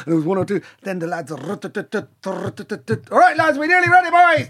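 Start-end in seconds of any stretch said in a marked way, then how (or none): 1.3-3.1 snare roll imitation. Imitating snare drum rolls. 3.1-4.5 high, fast shout to the band; rally the lads, quick and loud; high hurried call to the boys. Retold or imitated. Band leader calling to lads.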